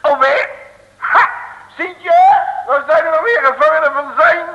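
high, loud outraged protest; a squawk of indignation. Acted. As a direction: bristle at being taken prisoner. Outraged at being captured again.

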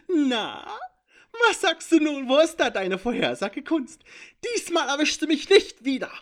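loud, high, cackling gloat; high mocking taunt. A narrator's reading. Intruder mocking the seer after slipping away, high gleeful taunt. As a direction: jeer at them like a slippery imp.

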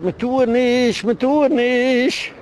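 high, drawn-out mock wail; imitated cry. Woman caught with a stranger, crying out in mock protest. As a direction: stretch each word into a high, mocking protest. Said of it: "und sie schreit".

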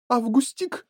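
high-pitched calling mother; mother joining in. A narrator's reading. Mother backing up the owner, high scolding call. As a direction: call out the pet name, rising. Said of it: "поддержала его миссис Глуп".

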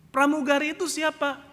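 loud, high, scornful question; Pak Mukidi. Retold or imitated. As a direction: question her angrily, as if she were nobody. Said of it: "Pak Mukidi marah-marah". (bertanya ketus dengan suara keras)

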